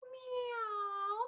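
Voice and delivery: high, very soft meow; a tiny soft kitten meow; a gentle meow for children; kitty meow. Shown as a demonstration. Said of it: "Can you go meow".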